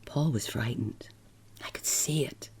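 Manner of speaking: hushed, whispered aside; whispered narration. A narrator's reading. Narrator whispering about frightened Paul. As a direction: breathe the words almost silently.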